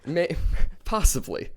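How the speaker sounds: breaking into a laugh mid-word. Real, not acted. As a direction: laugh through the word possibly. Laughing at partner's joke.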